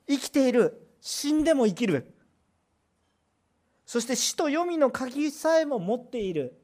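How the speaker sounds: loud, high, slow declaration; fiery sermon declaration; full-force preaching. Acted. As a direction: proclaim his power. Preaching crescendo on the living Lord.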